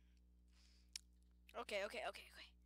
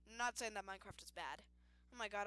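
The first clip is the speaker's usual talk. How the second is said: normal talking voice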